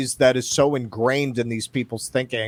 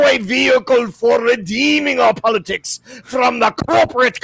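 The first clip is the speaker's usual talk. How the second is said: full fop; 9 semitones higher than usual. Acted. Reading the op-ed to the co-host as a pompous fop. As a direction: ham up a pompous aristocrat. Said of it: "let me just do it full fop".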